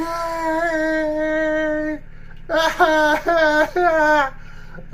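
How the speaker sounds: long, high, loud laughing fit; helpless high laughter. Real, not acted. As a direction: laugh till it hurts.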